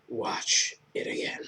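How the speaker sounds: spooky whisper. Pretend. Ominous movie-trailer close.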